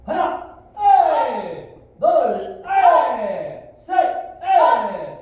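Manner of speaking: high, explosive counting yell; drill command shout. Real, not acted. Instructor calling kicks for students, shouting. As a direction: bellow the count to drive the class.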